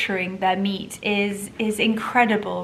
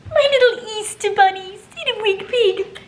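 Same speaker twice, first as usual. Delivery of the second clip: much higher and louder croon; 13 semitones higher, 7 dB louder than usual. Real, not acted. Cooing at dogs.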